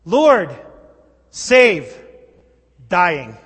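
three loud separate cries, falling at the end; desperate shouted cry for help; yelled plea. Acted. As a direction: yell it out word by word. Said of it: "the disciples yell out three words".

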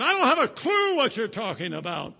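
high, loud protest; panicked denial. Acted. Peter denying Jesus to the servant girl, loud high protest. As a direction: shout the lie to get away.

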